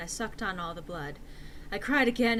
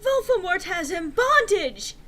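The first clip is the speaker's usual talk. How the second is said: urgent cry; 10 semitones higher, 9 dB louder than usual. Acted. Urgent vision announcement.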